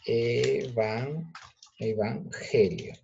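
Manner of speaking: EVANGELIO slowly in pieces, e-van, then evan-gelio; syllable by syllable. Shown as a demonstration. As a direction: break up EVANGELIO with pauses, stretching it. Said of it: "Repetimos la palabra".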